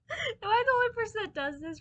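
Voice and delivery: high, loud laughing question. Real, not acted. I'm weird, laughing.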